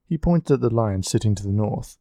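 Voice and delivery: usual speaking voice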